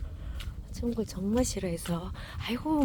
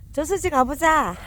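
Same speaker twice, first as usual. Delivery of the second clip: 저수지 가보자 called loud and high, with the weight on a lengthened 가보자; the stress falls on 가보자 this time; 6 semitones higher, 7 dB louder than usual. Real, not acted. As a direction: push the 가보자 out long. Repeating the call to the dogs.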